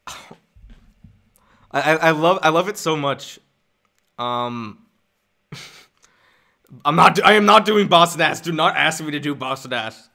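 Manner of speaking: ordinary delivery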